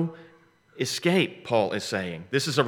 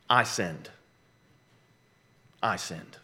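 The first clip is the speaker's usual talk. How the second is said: quiet confession; 6 semitones lower, 5 dB quieter than usual. Shown as a demonstration. Modelling confession, soft.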